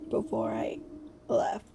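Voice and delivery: ordinary delivery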